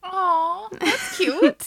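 high cooing aww. Real, not acted. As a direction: coo over something cute. Reacting to cute name.

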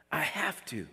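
hushed, definitive resolve; heartfelt conviction. Acted. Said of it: "they talk like this".

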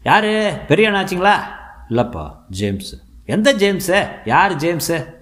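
high, loud and suspicious; suspicious doorman. A narrator's reading. Doorman demanding who the visitors are, high and loud. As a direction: challenge the stranger at the gate.

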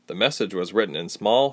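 ordinary delivery, nothing marked

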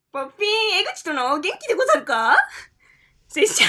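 high laughing read; breaking into laughter. Real, not acted. Reading letter, cracking up.

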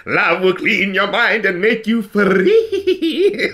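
slow sinister cartoon villain croon; slow hammed-up villain voice; Monarch impression. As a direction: croon it slowly like a cartoon supervillain. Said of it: "with the voice where he goes like".

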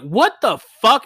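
high, loud what the fuck; disbelief. Retold or imitated. Comic hearing the bar tab accusation, loud disbelief. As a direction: pop it up in disbelief.

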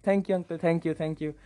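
softly imitated deferential gratitude; retold bowing-down thanks, soft and low; humble thanks. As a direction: thank him meekly, trailing down. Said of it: "I was bowing down to him".

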